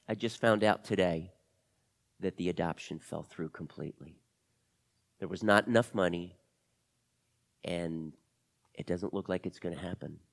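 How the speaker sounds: low, subdued sadness; downcast confession. Acted. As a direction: break bad news with quiet resignation. Crying Camila reveals failed adoption.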